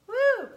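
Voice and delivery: high, loud "woo"; sharp whoop against the onion sting. Real, not acted. Cook reeling from onion fumes, loud high whoop.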